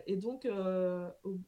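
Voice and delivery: normal talking voice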